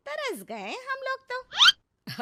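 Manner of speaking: high, slow, hammed-up longing; mock-dramatic complaint, high and slow; exaggerated pining. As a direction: overact how much you missed them. Nosy neighbour exaggerates longing.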